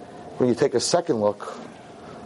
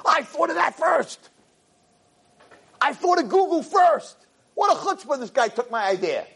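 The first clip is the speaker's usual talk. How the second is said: mocking whiny voice; 14 semitones higher than usual. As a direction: sneer it like a petty rival. Mocking the Google founder's boast.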